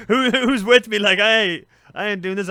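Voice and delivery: loud, high mock rabble-rousing; rallying protest. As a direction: call out to recruit the crowd. Rallying villagers to refuse.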